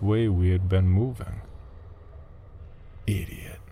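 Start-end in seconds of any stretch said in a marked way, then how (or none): 3.1-3.6 muttered under the breath, much softer; quiet inner thought. Acted. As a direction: say it to yourself, hushed. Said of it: "I thought to myself".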